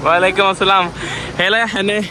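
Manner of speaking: ordinary delivery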